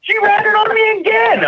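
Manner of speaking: very high-pitched exclamation; indignant outburst. Real, not acted. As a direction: squeal it in comic outrage at being told on. Indignant outburst while retelling betrayal.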